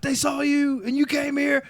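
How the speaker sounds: high, panicked mock outburst; hammed-up freakout at a friend; losing his mind. Retelling a stoner panicking that he was followed, high and frantic. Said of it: "now he's fucking losing his mind".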